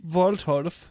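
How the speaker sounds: mock German name. Co-host mocking the father's name in a German accent. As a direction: say "Waldhof" as "Vowltorf", Germanic and pompous. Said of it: "mocking the name".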